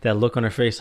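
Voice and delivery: ordinary delivery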